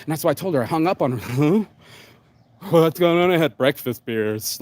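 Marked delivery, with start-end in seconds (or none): 1.2-4.6 imitated boozy, confused friend; drunk friend. Mimicking a drunk friend after breakfast beers, put-on confused voice. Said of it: "Like, totally passed out".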